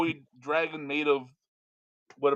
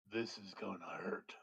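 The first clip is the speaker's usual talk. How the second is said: soft whispered dragon threat; 10 semitones lower, 21 dB quieter than usual. Acted. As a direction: whisper it low and ominous.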